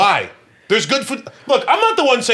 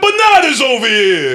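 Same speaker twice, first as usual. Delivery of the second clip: slow, loud Brooklyn Italian shout; mocking a friend's Italian-sounding accent; mock Italian guy accent; 5 semitones higher, 6 dB louder than usual. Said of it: "like an Italian guy".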